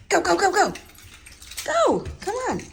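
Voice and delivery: high-pitched, loud go go go; high dog urging. Real, not acted. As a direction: cheer the dogs on up high.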